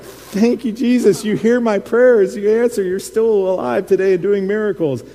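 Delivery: high, joyful burst of thanks to Jesus; excited thanks. Retold or imitated. Retelling his thanks for the answered dream, high and joyful. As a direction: pour out thanks like a prayer just answered.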